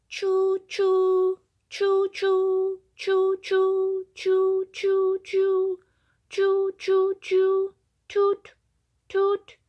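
loud, high choo-choo train sounds; loud high train whistle noises. A narrator's reading. Tiger imitates train.

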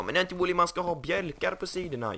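high, nagging imitation of viewers; a lightly voiced pushy viewer; mocking viewers. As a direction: mimic the know-it-all comments. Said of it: "Ni är typ bara".

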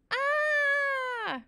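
loud, high-pitched scream; long high shriek of fear; terrified scream. A narrator's reading. Boy frozen upside down in the tree, screaming.

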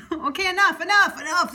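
loud, high, laughing plea to stop; laughing protest. Real, not acted. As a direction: call a halt through laughter. Halting the joking spirit, laughing.

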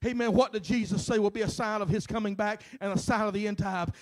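ordinary talk

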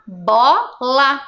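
"Bola" split into bó and la; syllable by syllable. Shown as a demonstration. Teacher joining syllables for pupils, "Bola" syllable by syllable. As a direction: sound out "Bola" in two separate syllables. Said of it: "O L e o A que formou lá".

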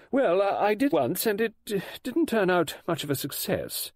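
high, hesitant admission; fluttery authoress voice; flustered lady novelist. Acted. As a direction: admit it awkwardly, pitched well up. Voices Missus Oliver answering.

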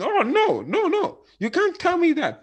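high, loud mock protest; mock shouting. Stranded passenger shouting at the bus driver, high mock yell. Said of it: "So when you now start shouting".

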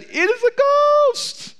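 sudden high frightened cry; terrified cry. A narrator's reading. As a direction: cry out in alarm at a ghost. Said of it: "they were terrified and said".

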